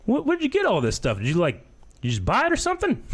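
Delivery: high, amazed question; hint of a baffled mover; imitating curious mover. Mover asking about collection.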